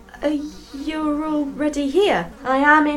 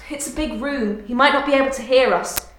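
ordinary talk

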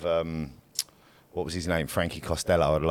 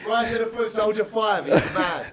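shouts the title, much higher, over the others; excited shout; 3 dB louder than usual. Real, not acted. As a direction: blurts it out, thrilled. Excited praise of a film.

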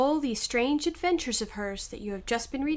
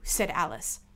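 usual speaking voice

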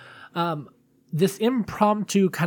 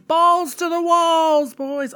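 movie quote impression; 13 semitones higher, 5 dB louder, much slower than usual. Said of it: "from down Periscope".

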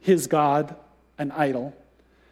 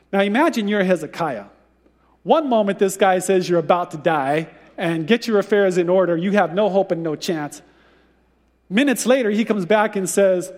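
usual speaking voice

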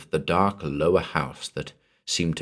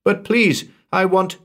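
desperate plea; 13 semitones higher, 5 dB louder than usual. Acted. Desperate woman cut off.

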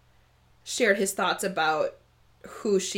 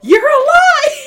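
high, loud, slow cry of relief; joyful yell; 14 dB louder, slower than usual. Retold or imitated. Reader acting Thalen yelling out to Cerulia, a loud joyful cry. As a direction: blurt it out at the top of your lungs. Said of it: "he yells out".

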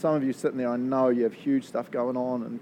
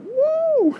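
very high, loud whooping cheer; joyful whoop; 10 dB louder than usual. Retold or imitated. Pastor whooping in delight at the psalm, loud and very high. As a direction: let out a celebratory woo.

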